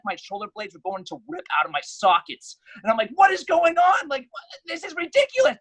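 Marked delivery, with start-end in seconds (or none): 3.1-5.6 high, loud outraged protest. Retold or imitated. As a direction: protest while being dragged off. Being carried off by cops.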